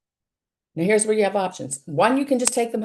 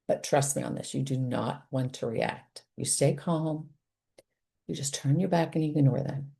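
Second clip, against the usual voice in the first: hushed firm advice; 5 semitones lower, 7 dB quieter than usual. Real, not acted. Confiding firm advice to parents.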